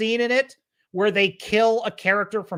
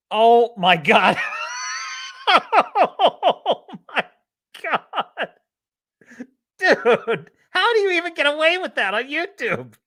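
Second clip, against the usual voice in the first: laughing shock; 9 semitones higher, 4 dB louder, slower than usual. Real, not acted.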